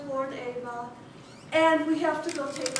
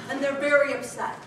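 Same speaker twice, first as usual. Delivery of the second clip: ordinary talk, nothing marked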